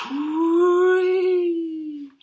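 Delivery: high, loud whooshing wind call; howling wind. Acted. The wild wind snatches the hats, loud howl. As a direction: blow it out like a gusting wind.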